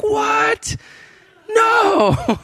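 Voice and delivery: very high squealed exclamations; hammed-up astonished squeak; shocked disbelief. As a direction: reel at the impossible coincidence. Retelling the couple's shocked reaction.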